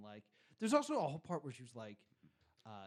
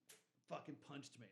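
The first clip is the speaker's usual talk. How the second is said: ordinary talk, nothing marked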